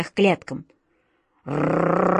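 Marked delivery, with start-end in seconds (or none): 1.4-2.1 rolled-r growl from the cages; long trilled animal growl; beast growl. A narrator's reading. Caged beasts answering the approaching cat, growl. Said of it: "послышалось из клеток".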